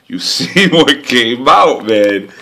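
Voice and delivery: loud, slow laugh through the words; exasperated laughing; laughing in frustration. Real, not acted. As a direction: laugh it out in disbelief. Laughing at missing the numbers.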